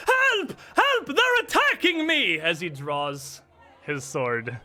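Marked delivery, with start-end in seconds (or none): 0.1-2.4 very high, loud theatrical cry of a victim; calling out for help. Acted. Villain faking an attack to turn the crowd, shrieking for help. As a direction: cry out in hammed-up alarm for a crowd. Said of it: "and calls out".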